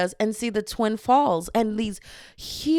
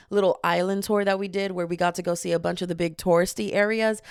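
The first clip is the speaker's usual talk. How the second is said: normal talking voice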